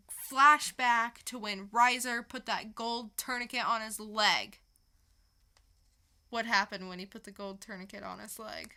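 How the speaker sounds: ordinary delivery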